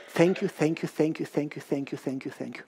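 fading thanks. Acted. Overwhelmed debtor thanking.

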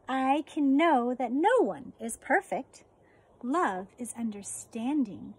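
slow, gentle storybook read; reading to children. Acted. Teacher reading a book on compassion to her fourth graders, gentle read-aloud. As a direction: read it tenderly to the class, pausing often.

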